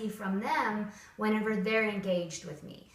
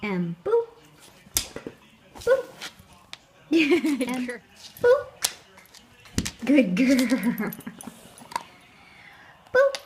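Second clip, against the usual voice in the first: playful dog talk; 3 semitones higher, 8 dB louder, much slower than usual. Real, not acted. Playing with the dog.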